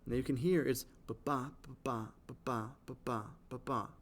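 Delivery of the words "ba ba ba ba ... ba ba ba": "ba-ba" repeated, weak then strong each time; iambic beat. Shown as a demonstration. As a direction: chant "ba-ba" with the second syllable stressed, over and over. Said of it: "unstressed, stressed, over and over".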